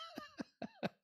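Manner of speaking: squeaky high-pitched giggle; real burst of laughter; giggling at his own joke. Real, not acted.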